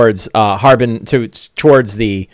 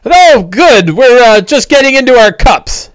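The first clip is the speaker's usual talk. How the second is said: very high, louder hailing dwarf voice; jolly dwarf; 12 semitones higher, 4 dB louder than usual. Acted. Dwarves greet the hero at the inn, jolly high voice.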